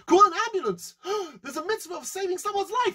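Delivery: high-pitched, gasping eager cry; hammed-up excitement. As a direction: seize the chance with breathless glee. Rich man thrilled at good deed.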